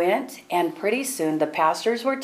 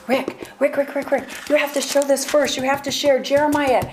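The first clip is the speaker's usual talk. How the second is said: higher, insistent repeated name; urgent pleading; 6 semitones higher than usual. Retold or imitated. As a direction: tug at him urgently, in a hurry. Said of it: "I'm like".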